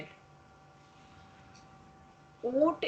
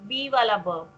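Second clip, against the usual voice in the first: ordinary delivery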